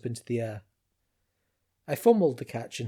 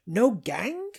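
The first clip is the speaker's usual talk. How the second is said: incredulous; 10 semitones higher, 3 dB louder than usual. A narrator's reading. Zenda incredulous.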